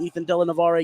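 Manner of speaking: normal talking voice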